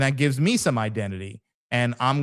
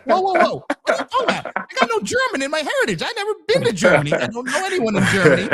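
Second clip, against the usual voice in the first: much higher, louder mock outrage; indignant protest; 4 dB louder, faster than usual. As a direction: act out a baffled, high-pitched objection. Acted protest at a wrong label.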